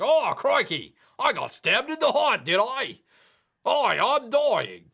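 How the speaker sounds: hammed-up high, loud Aussie death cry; Steve Irwin. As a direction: play a cartoon Australian dying, drawn out at the end. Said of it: "and it's like".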